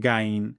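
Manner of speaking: গাইন with a plain hard g and open a; short, thin গাইন without throat friction; wrong gain. Shown as a demonstration. Teacher showing a common error, short hard-g গাইন. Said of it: "এই উভয়টি ভুল".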